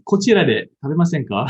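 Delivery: こちらで食べませんか said as a polite invitation. Shown as a demonstration. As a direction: ask courteously and brightly. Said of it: "or, like".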